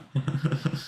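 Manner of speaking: real chuckle tailing off; laughing. Real, not acted. Streamer done playing with effects, trailing laughter. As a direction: laugh it off, fading away.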